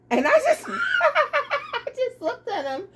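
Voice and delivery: real laughter spilling over; laughing. Real, not acted. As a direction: laugh through the words. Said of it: "I can't laugh".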